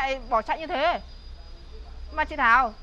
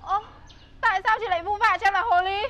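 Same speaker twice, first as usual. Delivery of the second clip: usual speaking voice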